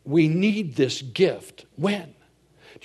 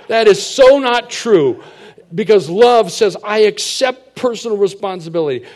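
much louder, higher, driving preacher voice; full-force preaching; 7 semitones higher, 9 dB louder than usual. Acted. Pastor rebuking the church about true love, preaching loudly. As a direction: hammer the point home to the congregation.

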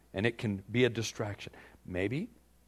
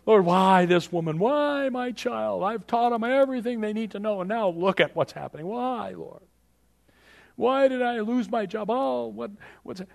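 whining prayer; 8 semitones higher, 5 dB louder than usual. Retold or imitated. Preacher voicing a believer whining about family troubles. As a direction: moan each why at God. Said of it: "instead of saying".

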